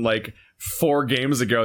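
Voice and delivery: usual speaking voice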